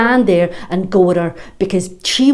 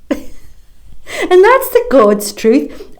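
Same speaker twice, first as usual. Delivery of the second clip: slow words through open laughter, higher and louder; laughing; 9 semitones higher, 8 dB louder, much slower than usual. Acted. Recalling the bully's threat, laughing hard. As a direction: laugh it out in disbelief.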